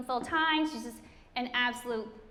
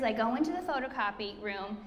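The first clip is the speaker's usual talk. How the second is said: normal talking voice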